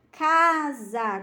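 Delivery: CASA with CA drawn out and pushed, za lighter; stressed first syllable. Shown as a demonstration. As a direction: raise the voice on the CA of "casa". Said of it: "ela levanta a sua voz".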